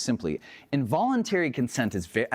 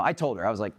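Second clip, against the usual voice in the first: normal talking voice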